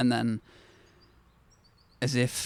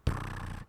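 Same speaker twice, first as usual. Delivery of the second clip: soft unvoiced lip trill; quiet rolling raspberry; rolled prrr noise; 6 dB quieter than usual. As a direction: mimic a voice sputtering out with a prrr. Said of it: "this voice just going".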